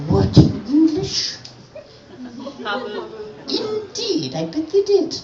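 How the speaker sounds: mock the haughty English nun, very high; prim English nun. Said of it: "in her English Oxford accent".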